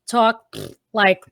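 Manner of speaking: halting, a mouth noise after each word; cartoon townsfolk's punctuated talk; SpongeBob townsfolk speech. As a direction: break it up word by word with sputters. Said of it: "they always talk like this".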